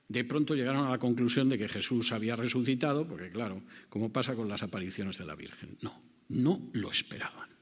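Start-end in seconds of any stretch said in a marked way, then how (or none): 5.8-7.5 hushed whisper. Real, not acted. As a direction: drop to a whisper on the denial. Preacher stresses they did not expect.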